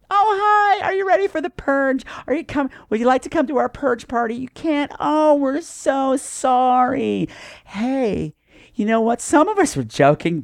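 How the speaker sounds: high, gushing fake-smile voice; manic Stepford wife. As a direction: play a sugary neighbour through a huge fake smile. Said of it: "Stepford wife manic, huge fake smile".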